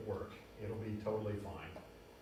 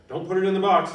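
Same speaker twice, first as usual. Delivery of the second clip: higher and much louder warning; emphatic warning; 8 semitones higher, 14 dB louder, faster than usual. Real, not acted. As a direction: call it out so nobody makes the mistake. Teacher warns students firmly.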